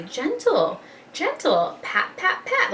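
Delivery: high, soft, singsong coaxing. Retold or imitated. Mom coaching her toddler to touch softly, high coaxing. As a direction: coo it gently like to a toddler. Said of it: "I say".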